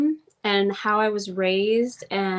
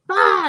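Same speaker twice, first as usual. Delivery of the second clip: higher, louder mock bark "Bah"; short mock outburst of temper; blunt outburst; 6 semitones higher, 7 dB louder than usual. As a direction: blurt it like a fiery Sagittarius. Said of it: "So it's like".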